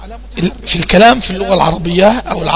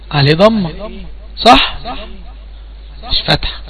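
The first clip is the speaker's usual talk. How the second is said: usual speaking voice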